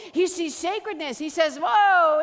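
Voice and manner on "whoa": loud, higher-pitched whoa; amazed exclamation. Acted. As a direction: exclaim it loudly in wonder. Voices Jesus amazed at blind man.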